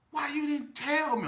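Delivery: high, loud mock-distraught wail; acting distraught. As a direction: put on a pained, high-pitched protest. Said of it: "I'm trying to act like I'm distraught".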